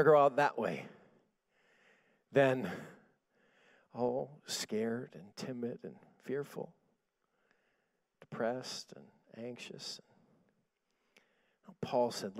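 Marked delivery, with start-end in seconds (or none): 3.9-10.0 slow, low, shrinking voice; timid and fearful. Acted. Preacher mocking dying scared and anxious, timid hushed voice. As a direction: let it fade away, small and halting.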